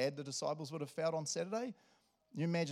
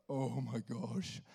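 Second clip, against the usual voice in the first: stunned dismay; much slower than usual. Acted. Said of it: "And I'm just like".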